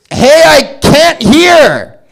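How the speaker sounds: very slow, high, loud New York drawl; New York Buzz Lightyear. Retold or imitated. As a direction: stretch it out like a Brooklyn toy hero. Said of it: "This is a deaf guy in New York".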